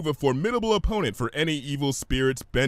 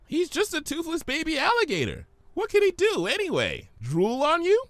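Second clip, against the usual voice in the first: bubbly Pinky. Acted. Pinky teases Fluttershy, high bubbly voice. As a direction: play it giddy and cheeky.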